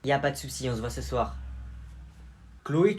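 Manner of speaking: normal talking voice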